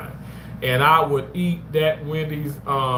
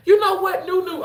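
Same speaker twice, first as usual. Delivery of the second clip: excited call-out; 3 dB louder than usual. Real, not acted. Reacting to a viewer comment.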